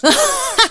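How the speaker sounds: short high laugh breaking through the reading; a quick real giggle at the widow's description; amused chuckle. Real, not acted. Narrator laughs at buxom widow.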